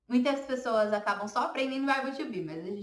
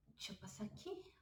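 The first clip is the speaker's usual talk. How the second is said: whisper; 18 dB quieter than usual. Real, not acted. Aside while changing slide.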